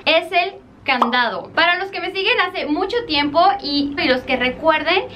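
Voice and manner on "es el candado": normal talking voice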